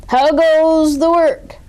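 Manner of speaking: high, loud, slow demanding call; lazy farmer shouting from bed; farmer's voice. A narrator's reading. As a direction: bellow it like a bossy farmer. Farmer voiced in read story.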